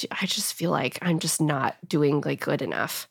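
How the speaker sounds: soft whisper of a worried daughter. Retold or imitated. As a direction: whisper it like a child at bedtime. Retelling daughter's sad bedtime words.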